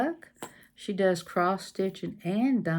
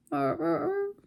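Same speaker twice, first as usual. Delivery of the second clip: dog bark; 11 semitones higher than usual. Retold or imitated. Imitating a dog.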